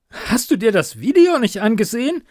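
loud, high, pent-up question; bursting out. A narrator's reading. Reporter bursting out at her editor, loud. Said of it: "platzte es endlich aus ihr heraus".

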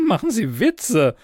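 high-pitched acted laugh into the words; amused exclamation. A narrator's reading. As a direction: exclaim it through a chuckle. Amused officer exclaims after laugh.

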